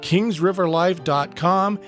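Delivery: ordinary delivery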